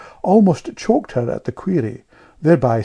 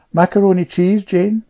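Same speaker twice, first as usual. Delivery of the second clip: raised, louder questioning hostess; lightly voiced hostess at table; hostess offering food; 8 semitones higher, 6 dB louder than usual. A narrator's reading.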